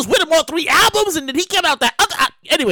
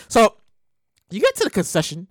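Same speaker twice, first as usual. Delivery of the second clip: ordinary talk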